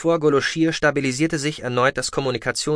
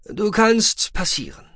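higher, slow official clearance; matter-of-fact officer; 9 semitones higher, slower than usual. A narrator's reading. Officer letting Goloshir's ship pass, matter-of-fact. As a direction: pronounce it slowly, as formal permission. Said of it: "sagte der Akonide sachlich".

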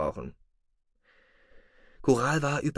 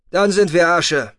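bored, disillusioned; 7 semitones higher, 8 dB louder than usual. Acted. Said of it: "so gelangweilt und desillusioniert".

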